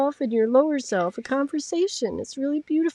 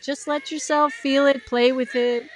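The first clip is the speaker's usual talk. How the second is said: normal talking voice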